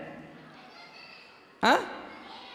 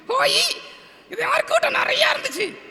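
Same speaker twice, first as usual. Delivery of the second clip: high-pitched puppet storyteller; டிங்கன்; 12 semitones higher than usual. Pretend. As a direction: tell a tale in a tiny squeaky character voice. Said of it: "ஒரு கதை சொல்லணும்னு". (கதை சொல்லும் கீச்சுப் பொம்மைக் குரல்)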